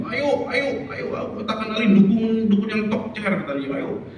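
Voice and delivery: high-pitched acted urging; imitated trader's persuasion; coaxing fellow vendors. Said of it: "teman-temannya di sekitar yang dagang itu selalu bilang begitu".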